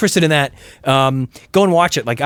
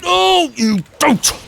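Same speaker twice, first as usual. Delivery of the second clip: very high, drawn-out cry; slow, squealed game reaction; high mock warning; 13 semitones higher, much slower than usual. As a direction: stretch it high to stop the enemy.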